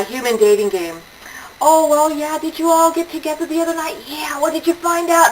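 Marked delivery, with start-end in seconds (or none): 1.6-5.3 gossipy friend. Retold or imitated. Imitating gossiping women.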